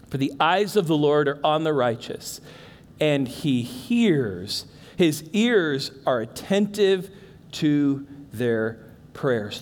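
soft and slow, fading low at the end; hushed, slowing scripture reading; tender scripture reading. Acted. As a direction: read it like a quiet promise. Reads scripture softly, slowing.

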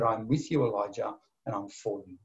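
soft, low and hushed, each phrase fading; gentle voice of God. Acted. God reassuring Elijah, soft and low. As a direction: murmur it tenderly like a close comfort.

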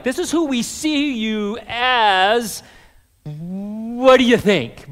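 slow, hanging "What do you think?" left open; open invitation. Retold or imitated. Preacher voicing Nicodemus's open question to Jesus. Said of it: "kind of like".

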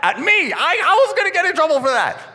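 high, loud mock outrage; hammed-up aggrieved squeal; comic indignant protest. Comic protest at being blamed in story.

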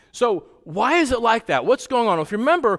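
ordinary talk, nothing marked

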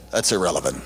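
very low, understated brush-off; deadpan dismissal. Retold or imitated. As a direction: imitate a serious pastor dismissing the question. Quoting pastor's curt reply, low.

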